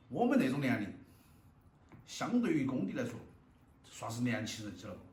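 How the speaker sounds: normal talking voice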